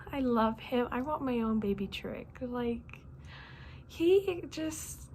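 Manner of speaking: ordinary talk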